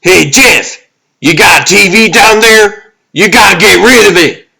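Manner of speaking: very high, loud warning yells; frantic shouting. Acted. Calling to Jeff to get rid of the TV, frantic shouting.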